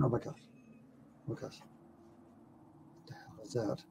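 hushed terrified whisper; scared whisper. Retold or imitated. Lying still in the dark woods, a scared whisper. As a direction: whisper it, frozen in the hammock.

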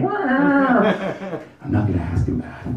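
high slow whine; stall, whining to the cat; whiny refusal. Retold or imitated. Refusal again softer.

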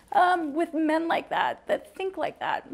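slow, high and close to tears; words squeezed out through rising tears; tearful. Real, not acted. Mother fearing men like that, slow and tearful. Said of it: "I hate getting emotional".

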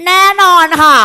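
high, loud, emphatic reply; hammed-up eager schoolgirl answer; acted confident pupil. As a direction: answer as an over-confident student. Acting self-assured pupil Emily's reply.

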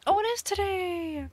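high, excited exclamation; delighted surprise. Real, not acted. Streamer finding Tad's birthday is today, delighted surprise. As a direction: cry it out high in happy discovery.

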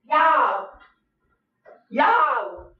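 high, loud cry to the viewers; alarmed call. Real, not acted. Calling out to the viewers after catching something on camera, loud and high. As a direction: call out to everyone, startled.

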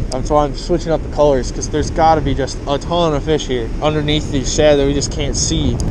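ordinary delivery

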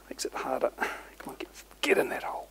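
whispered while concentrating; breathy whisper, coaxing it through; threading a needle. Real, not acted. Threading a zip tie through.

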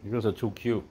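normal talking voice

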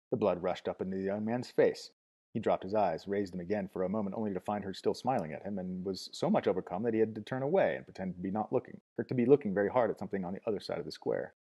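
usual speaking voice

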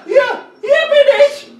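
high excited calls, laughing; calling out. Real, not acted. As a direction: yell for your teammate to spot you. Calling teammate in game.

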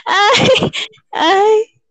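high, tearful nervous sighs; trembling with nerves; adrenaline shakes. Real, not acted. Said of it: "estoy temblando".

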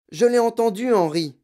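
high, loud yelping protest; quavering yelp. A narrator's reading. Mother insisting to husband, yelping. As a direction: yelp it in a fright. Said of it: "glapit la mère, d'une voix toujours aussi chevrotante".

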